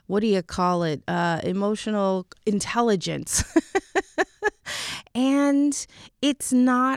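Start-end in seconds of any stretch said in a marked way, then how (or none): 3.5-5.1 quick high laugh at herself; amused little giggle; self-conscious giggle. Real, not acted. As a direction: laugh off the fumble. Laughs at her own word search.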